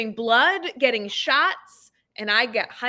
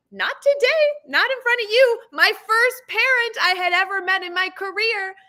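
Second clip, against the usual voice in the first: nervous high bravado; 10 semitones higher, 3 dB louder than usual. Retold or imitated. Nervous young teacher retold.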